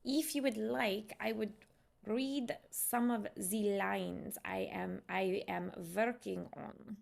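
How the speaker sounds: deliberate, halting Russian accent. Shown as a demonstration. Streamer offering to read lines she is working on, Russian accent. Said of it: "Russian accent".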